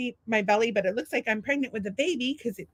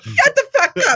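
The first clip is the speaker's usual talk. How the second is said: loud, high outburst through laughter; mock angry retort; 10 semitones higher, 9 dB louder than usual. Wes snapping back at his doll twin, mock shouting. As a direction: pretend to yell back at a sibling, laughing. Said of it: "Wes will be like".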